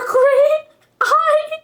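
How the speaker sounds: drawn-out, very high cracking wail; hammed-up high-pitched breakdown; mock wail. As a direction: break into a squealed, laughing sob. Reader breaks into mock wailing.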